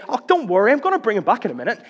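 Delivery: loud, high, fast breezy reassurance; mock breezy triumph. Jesus as he does not say it, breezy and triumphant. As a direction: toss it off cheerfully as if nothing matters. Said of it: "He doesn't say it like that".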